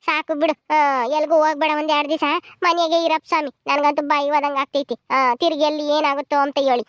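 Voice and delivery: ordinary delivery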